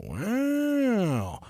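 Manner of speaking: breathy little high wow of wonder; a small child amazed at the pile of food; awed wow. Retold or imitated. Toddler amazed at overflowing dog food, soft awed wow. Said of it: "She's like".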